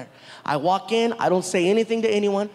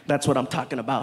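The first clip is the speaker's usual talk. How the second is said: low, confiding approval; intense director; 7 semitones lower than usual. Retold or imitated. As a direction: praise him right up close, low. Director gets in his face.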